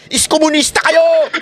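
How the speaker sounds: high, slow, mocking cry of an angry critic; mock accusing yell. Mocking critics' old insults. (panggagaya sa galit na sigaw ng mga kritiko)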